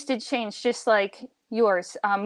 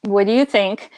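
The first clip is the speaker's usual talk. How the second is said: usual speaking voice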